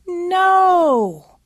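loud, high, drawn-out shout; acted shout. Mother imagining a money talk with her son, shouting. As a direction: cry out a long refusal that falls at the end. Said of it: "I won't be the one shouting".

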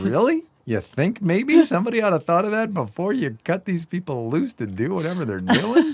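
high-pitched, disbelieving rhetorical question; mock them for missing the obvious; incredulous sarcasm. Mocking reply to Pence's plea.

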